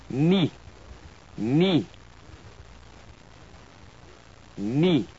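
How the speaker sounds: "Ni" with a pure French i, no glide; French pronunciation. Shown as a demonstration. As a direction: model "Ni" clearly for repetition. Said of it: "followed by the French word twice".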